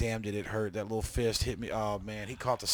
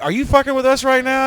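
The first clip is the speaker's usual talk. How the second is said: high, loud imitation of fed-up customers; exasperated customer; 14 semitones higher, 16 dB louder than usual. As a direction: mimic an incredulous customer yelling. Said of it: "And they're like".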